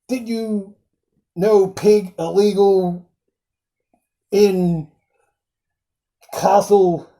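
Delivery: lightly put-on pompous sheriff, slow; a hint of a fancy-pants sheriff mangling his words; imitating the sheriff. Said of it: "phrases like".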